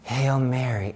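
retold silent prayer, kept under the voice; said inwardly. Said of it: "I said in my head".

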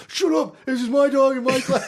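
put-on high, loud indignant snap at his girlfriend; hammed-up outrage, half laughing; mock angry shout. Retelling a class fight with his girlfriend, loud mock shout.

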